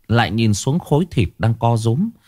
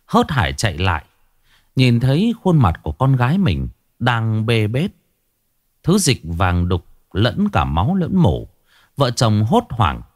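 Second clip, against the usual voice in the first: ordinary delivery, nothing marked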